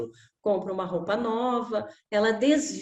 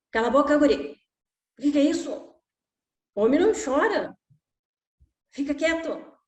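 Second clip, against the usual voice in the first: scolding father; 4 semitones higher than usual. Retold or imitated. Disapproving father telling crying son to shut up. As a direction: snap at the kid to stop crying.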